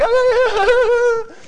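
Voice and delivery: long, loud, high mock howl; imitated overcome wail. Children going back to crying, hammed-up wailing. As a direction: wail like an overwhelmed child, exaggerated. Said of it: "And they're like".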